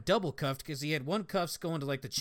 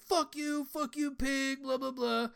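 hostile inmate jeer; 10 semitones higher, slower than usual. Retold or imitated. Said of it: "and he's".

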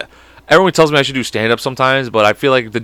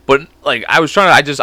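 ordinary delivery

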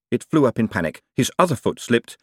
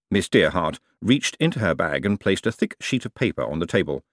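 usual speaking voice

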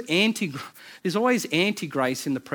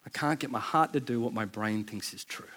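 softer, lower, letting it sink in; quiet reflective repeat; 6 semitones lower, 7 dB quieter than usual. Shown as a demonstration. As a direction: say it again quietly, to yourself. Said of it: "repeated quietly".

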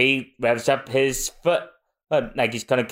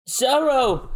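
high call out; 12 semitones higher than usual. Acted. Calling out.

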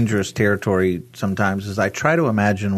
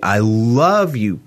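slow, tender declaration; much slower than usual. Acted. Voicing God telling the listener he loves them, slow and tender. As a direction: say it slowly like a gentle promise.

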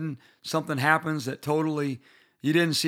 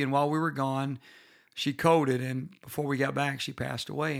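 usual speaking voice

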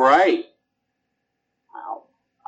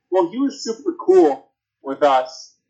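lightly voiced team exec, a bit higher; mock team owner; 5 semitones higher than usual. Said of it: "just be like".

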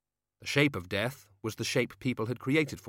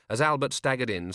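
ordinary delivery

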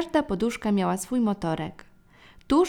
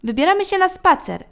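put-on high, loud child's shout; 9 semitones higher, 8 dB louder than usual. A narrator's reading. Youngest brother shouts to dad, high and loud. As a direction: call out like an eager little boy. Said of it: "krzyknął nasz najmłodszy brat".

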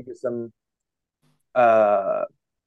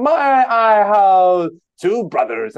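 high, slow, strained cartoon-man voice, louder; imitating the eye hole guy; 6 semitones higher, 7 dB louder, much slower than usual. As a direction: mimic the friends quoting the sketch. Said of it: "you guys were just continuously sitting there going".

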